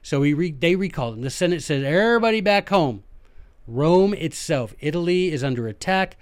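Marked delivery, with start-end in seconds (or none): 1.8-3.0 raised pitch, calling out an order; mock official recall call; urgent summons. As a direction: summon everyone home, a little louder. Senate recalling the armies.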